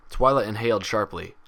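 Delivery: usual speaking voice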